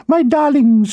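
a fat lady trilling over her Pekingese; trilled. A narrator's reading. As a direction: coo it high to her little dogs. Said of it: "she trilled".

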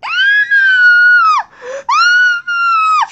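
two long, very high screams. Pretend. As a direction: scream loudly as if hurt in play. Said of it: "[name], stop screaming".

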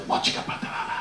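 soft breathy mock-Spanish whisper; imitated sultry Spanish whisper; breathy and sexy. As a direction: whisper it seductively, all breath. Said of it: "It was so breathy and so sexy".

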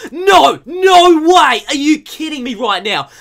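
very high, loud cry of amazement; disbelieving shout. Real, not acted. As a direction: yell it in thrilled disbelief. Disbelief at third holo card.

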